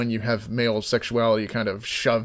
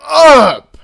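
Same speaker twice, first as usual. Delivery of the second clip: much higher, much louder exasperated yell, slowing at the end; yelling at porn; 10 semitones higher, 9 dB louder, much slower than usual. Retold or imitated. Yelling at a moaning porn actor, furious. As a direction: scream at the moaning guy to stop. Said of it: "and I'm like".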